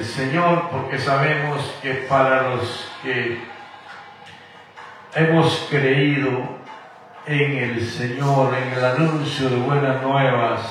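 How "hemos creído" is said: usual speaking voice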